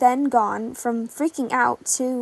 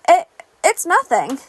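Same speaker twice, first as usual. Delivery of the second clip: forced brightness; 3 semitones higher, 4 dB louder than usual. Acted. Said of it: "trying to put a smile on his face".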